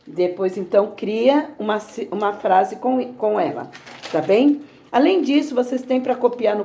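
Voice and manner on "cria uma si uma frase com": ordinary talk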